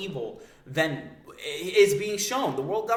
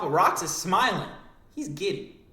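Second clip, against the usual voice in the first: ordinary talk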